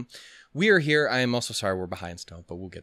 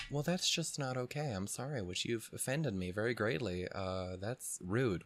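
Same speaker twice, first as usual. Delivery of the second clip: softer, prissy polite offense; prim offended believer; 10 dB quieter than usual. Retold or imitated. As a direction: act politely scandalised, quietly. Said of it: "you probably wouldn't talk like".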